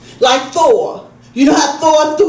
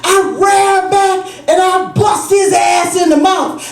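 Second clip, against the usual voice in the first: mom's proud retelling; 6 semitones higher than usual. Retold or imitated. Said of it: "She said".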